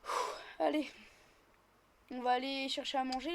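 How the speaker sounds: ordinary delivery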